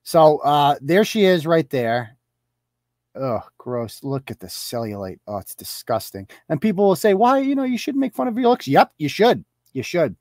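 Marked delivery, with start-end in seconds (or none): 3.2-6.3 low, quiet revulsion; subdued grossed-out commentary; disgusted. Real, not acted. Reacts with disgust to photo. 7.1-8.7 high, prissy imitation of scolds; high-pitched mocking do-gooder; mock objectors.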